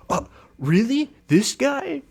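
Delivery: high, scoffing "Oh, really? This guy?"; mocking disbelief. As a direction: sneer at the scrawny rival in a high voice. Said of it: "Noah Centineo goes".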